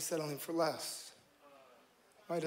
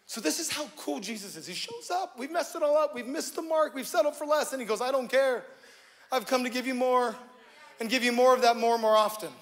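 rising into a loud, higher preacher's call; full-force preaching; 6 semitones higher, 9 dB louder than usual. Acted. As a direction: preach it out, building to the promise. Preaching, building to Jesus' promise.

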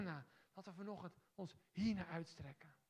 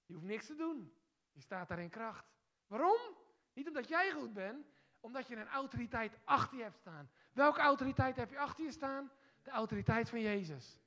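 loud, high, forceful preaching; fiery revival preacher; preaching at full force; 5 semitones higher, 12 dB louder than usual. Acted. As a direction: proclaim it to the whole hall. Preacher declaring authority.

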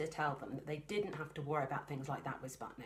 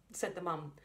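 ordinary delivery

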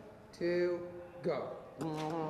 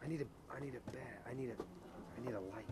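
hushed; 6 semitones lower, 10 dB quieter than usual. Pretend. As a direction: murmur it in the dark. Spooky home video scene.

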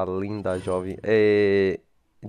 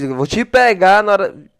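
loud, high mock threat; 8 semitones higher, 7 dB louder than usual. Gamer imitating the villain's threat to viewers, high and mocking. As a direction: rattle it off high, making fun of him.

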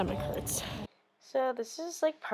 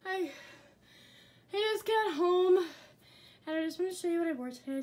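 out of breath; 6 semitones higher, faster than usual. Real, not acted. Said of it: "I'm so out of breath".

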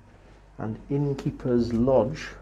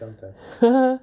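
sarcastic tag; 9 semitones higher, 11 dB louder, much slower than usual. Real, not acted. Sarcastic tag question, partner laughs.

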